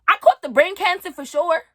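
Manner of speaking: rising panic; alarmed. Acted. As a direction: blurt it out in alarm. Escalating punchline.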